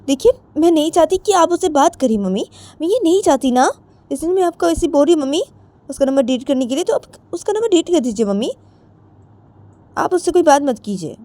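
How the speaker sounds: put-on high, insistent young woman; Jiya's voice. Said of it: "तो जिया बोलती है".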